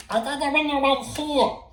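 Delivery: very high, loud puppet voice; squeaky monster explaining. Acted. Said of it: "he's a little bit loud".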